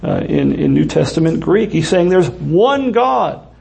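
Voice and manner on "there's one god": slow "there's one God", weight on "one"; slow down and press "one"; stress on one. Shown as a demonstration. Preacher driving home Paul's point, slow stress on "one". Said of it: "He says it emphatically".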